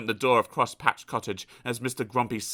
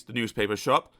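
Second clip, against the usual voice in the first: plain reading voice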